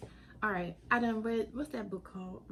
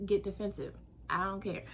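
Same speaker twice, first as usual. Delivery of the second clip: ordinary talk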